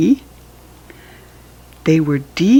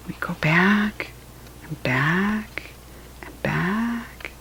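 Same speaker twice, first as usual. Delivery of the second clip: fading whisper; 9 dB quieter, slower than usual. A narrator's reading. Repeating, fading away.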